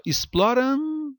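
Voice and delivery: "exploram" com ã puro no fim, sem ditongo; pronúncia errada. Shown as a demonstration. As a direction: segure o final de "exploram" agudo, num ã parado. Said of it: "ou você fala exploram?". ("exploram" terminado em "rã" suspenso)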